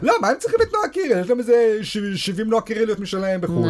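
high, fast mock outrage. Mock outraged foreign view.

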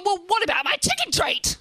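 high, loud comic outrage; indignant shout. Acted. Thinking of her forgotten takeaway, indignant shout. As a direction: wail it like a cheated customer.

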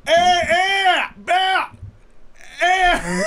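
high, loud yelps in a put-on Texas accent; hammed-up Texas shouting. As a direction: holler it like a hammed-up Texan. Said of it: "My Texas accent rolls".

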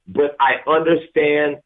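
normal talking voice

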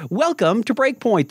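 ordinary delivery

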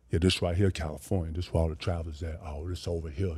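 very low, soft grown-man impression; mock deep man, quietly rumbling low. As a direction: drop way down like a kid playing grown. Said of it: "deep ass, grown man voice".

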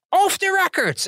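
very high, loud mock yell; sudden imitated shout. Reporter mimics minister cutting off interview, sudden high shout. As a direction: burst out screaming like a panicked minister. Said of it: "ineens schreeuwde".

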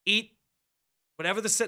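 ordinary delivery

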